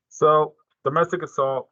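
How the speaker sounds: ordinary talk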